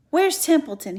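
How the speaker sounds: high, loud demand; urgent demand. Acted. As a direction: demand an answer now. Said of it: "he demanded".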